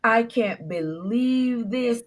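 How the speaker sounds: slow, drawn-out imitation wail; long moaning cry, acted out in retelling; mock bawling. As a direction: imitate a man bawling, stretching every word. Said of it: "And he started bawling".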